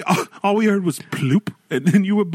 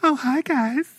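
put-on higher bubbly girly greeting; girl voice; 7 semitones higher than usual. Nick does his other girl voice for friends, perky. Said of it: "that other one you had".

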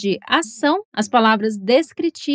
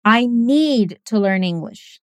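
very slow, "need" stressed most; 3 semitones lower, slower than usual. Shown as a demonstration. Teacher showing a speaker who stresses "need". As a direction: lean on "need" to show the urgency. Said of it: "Vamos falar o segundo exemplo juntos".

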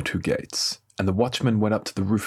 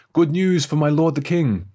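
raised, louder announcement; heralding good news; 6 semitones higher, 5 dB louder than usual. A narrator's reading. Messenger brings the king news of victory, heralding. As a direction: proclaim it high and loud to a king.

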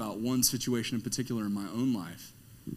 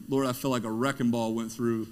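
ordinary talk, nothing marked

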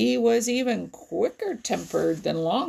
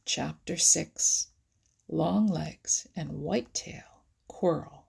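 plain reading voice

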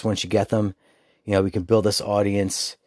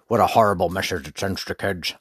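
imitated prim reviewer. Parent reviewer warning others off a film, mock disapproval. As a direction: put on a scolding film critic. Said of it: "exactly what the person sounded like".

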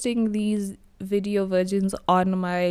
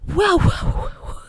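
amazed wow; 9 semitones higher than usual. Real, not acted. Amazed exclamation.

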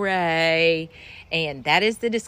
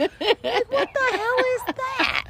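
incredulous; slower than usual. Retold or imitated. Incredulous reaction retold.

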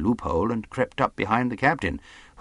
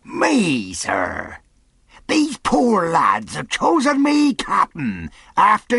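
loud, high, drawn-out answer; calling out; 12 semitones higher, 6 dB louder, much slower than usual. A narrator's reading. Long John answers the captain for himself, calling out. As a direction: holler it with pointed politeness. Silver calls his answer across stockade.

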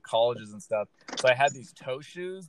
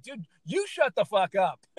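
higher, slower mock snap at him; mock exasperation; 8 semitones higher, 3 dB louder, slower than usual. Comedian in back pain mocking the chatty doctor, exasperated. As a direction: pretend to tell him off, half laughing.

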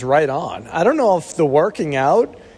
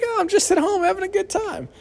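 much higher, quick fake-cheery voice; fake cheerful cover; faster than usual. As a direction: sell the lie breezily, everything's fine. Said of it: "I'd be like".

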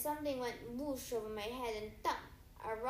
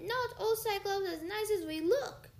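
put-on childlike giant; 9 semitones higher, 6 dB louder than usual. Tyson's simple giant voice.

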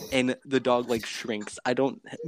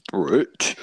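burped word; 4 semitones lower, 3 dB louder than usual. Retold or imitated. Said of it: "she burps and it goes".

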